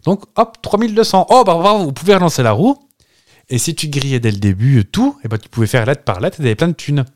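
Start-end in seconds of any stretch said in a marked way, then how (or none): 0.1-2.8 high, bouncy mock host congratulating; hammed-up host voice, much higher; game show host. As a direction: play the cheesy TV host cheering a player on. Imitating Wheel of Fortune host.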